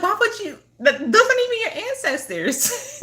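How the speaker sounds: high, laughing through the retort; can barely ask it for laughing; laughing disbelief. Retold or imitated. Laughing at a client about her fake ancestors. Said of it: "I'm like".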